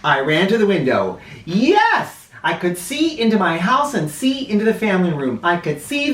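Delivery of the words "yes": ordinary delivery, nothing marked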